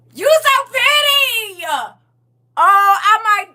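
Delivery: very high, loud, slow squeal through laughter; laughing shriek. Real, not acted. Teasing a friend about leaving clothes on the porch, laughing shriek. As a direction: shriek it while cracking up.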